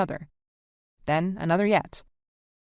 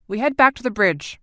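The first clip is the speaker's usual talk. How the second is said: louder brisk command; decisive and clipped; crisp order; 4 semitones higher, 6 dB louder than usual. A narrator's reading. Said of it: "he told the others crisply".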